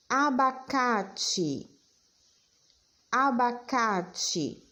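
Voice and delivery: patê-style dictation, each word stretched slowly syllable by syllable. Shown as a demonstration. Teacher dictating words to children, slow and deliberate. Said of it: "Próxima palavra".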